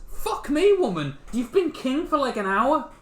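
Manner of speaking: high incredulous outburst; shocked, loud and high; exasperated disbelief. Real, not acted.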